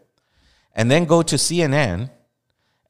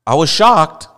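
high, loud exaggerated protest; mockingly aghast, voice pitched up; mock shock; 5 semitones higher, 8 dB louder than usual. Mocking the man's excuse.

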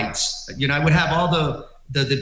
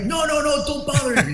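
high, frantic no no no; squeaky panicked protest; panicked plea; 11 semitones higher than usual. Retold or imitated. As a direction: beg him not to bother anyone. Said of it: "I'm like".

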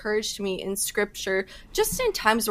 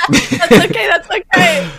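laughing; 8 semitones higher, 12 dB louder than usual. Real, not acted. Laughs at interviewer's late question.

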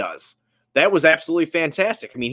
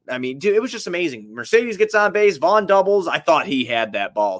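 ordinary talk

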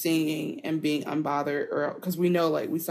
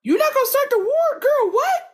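much higher and louder, laughing in disbelief; incredulous mock outrage; 6 dB louder than usual. Laughing co-host mimics Corlys balking at peace talk, incredulous squeal. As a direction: squeal it out like you can't believe her. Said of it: "and Corlys is like".

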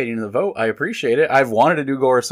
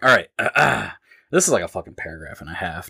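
usual speaking voice

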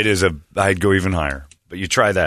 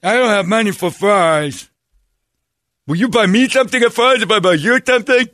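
dopey character voice; 11 semitones higher than usual. Pretend. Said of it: "he went full R word".

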